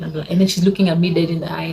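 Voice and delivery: ordinary talk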